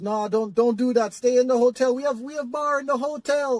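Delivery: put-on Jamaican accent, rising in pitch. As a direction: imitate an insistent Jamaican receptionist, pitched high. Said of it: "I'm gonna do my Jamaican accent".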